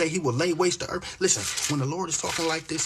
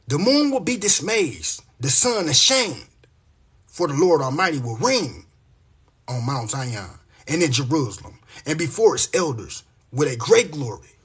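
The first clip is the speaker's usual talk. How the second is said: measured, rhythmic Bible reading, a little louder; scripture reading; 6 dB louder than usual. Acted. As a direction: read the verses like a preacher. Reads Isaiah aloud.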